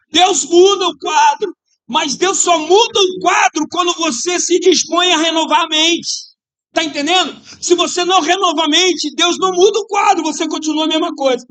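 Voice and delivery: shouted, high preaching voice; fiery preaching. Real, not acted. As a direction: preach it out with full force. Preaching at full force.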